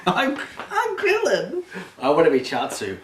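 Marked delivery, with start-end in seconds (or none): 0.1-1.6 slow, high goofy Krillin. Pretend. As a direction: drawl it out laughing. Playing Dragon Ball characters.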